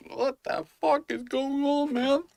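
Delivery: high, slow put-on dog voice; the dog's voice. Owner voicing his collie's confusion, high and slow. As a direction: play the confused dog talking. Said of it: "he's like".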